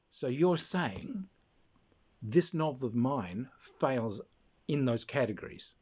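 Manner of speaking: retold deliberate question, spaced out with pauses; careful, drawn-out response in own voice; slow, measured reply. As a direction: slowly check what was meant, phrase by phrase.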